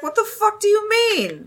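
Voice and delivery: high, hammed-up baffled yell; mock-confused homeowner; baffled villager. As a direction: play the confused local, voice way up. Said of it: "Villager's like".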